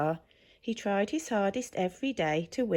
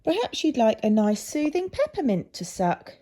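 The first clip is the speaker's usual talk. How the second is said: put-on higher and louder, eager little girl; a bright-eyed girl offering a sweet; girl's sympathetic voice; 7 semitones higher, 5 dB louder than usual. A narrator's reading. Girl sympathises with hoarse Zog, higher and louder girl voice. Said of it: "She said".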